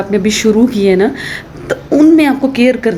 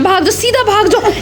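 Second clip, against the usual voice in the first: shooing away; 11 semitones higher than usual. Real, not acted. Shooing her shy son away.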